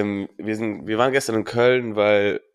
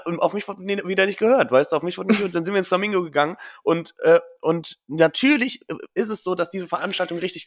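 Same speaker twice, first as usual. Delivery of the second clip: indignant, much higher complaint; 7 semitones higher than usual. Real, not acted. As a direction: whine that nobody listened. Complaining she was ignored.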